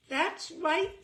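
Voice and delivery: a pleased fan's mock triumph; triumphant fan. Fan triumphantly naming him wrongly.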